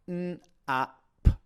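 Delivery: each sound said apart, n, a, p; sounding out words. Shown as a demonstration. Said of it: "blend the sounds together".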